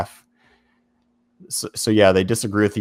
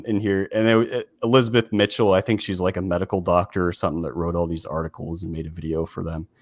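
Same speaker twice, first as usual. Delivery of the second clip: ordinary delivery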